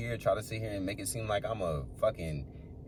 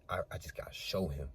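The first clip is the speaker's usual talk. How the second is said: softly voicing God's words, much quieter; a quiet, confiding retold line; hushed quote; 5 semitones lower, 11 dB quieter than usual. Speaker retelling what God said to him, hushed.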